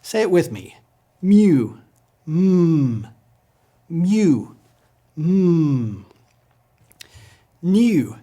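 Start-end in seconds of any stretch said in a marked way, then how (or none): 1.2-6.1 "Mu" as "myoo", then a long hummed "Mm"; M sound. Shown as a demonstration. Teacher leads repetition, humming M. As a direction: alternate "Mu" with a sustained hum. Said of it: "it is their M sound".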